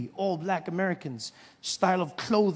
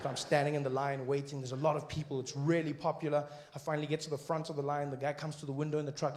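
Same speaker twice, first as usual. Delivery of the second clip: ordinary talk, nothing marked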